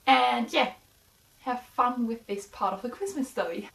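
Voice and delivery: usual speaking voice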